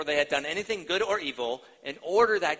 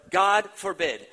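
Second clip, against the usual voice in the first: louder, forceful God forbid; strong emphatic refusal; 4 semitones higher, 7 dB louder, slower than usual. Retold or imitated. Preacher to congregation showing Paul's strongest no, louder. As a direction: slam the door on the idea. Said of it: "that's the strongest form of a negative answer".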